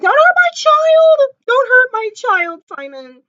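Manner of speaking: high-pitched, drawn-out mock cry; mock wail. Fan crying out to protect a child, mock wail. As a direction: wail it high, fading on the name. Acts out her reaction to the show.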